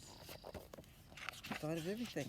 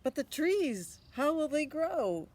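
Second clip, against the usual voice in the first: higher, louder skeptical mother; questioning mother; 11 semitones higher, 10 dB louder, slower than usual. Acted. Mother challenging her son, higher and louder. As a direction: press him like a doubtful mom.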